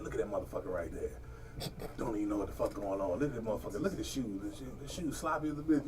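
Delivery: muttered commentary. Retold or imitated. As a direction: rattle it off low under your breath, mocking. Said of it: "I be like".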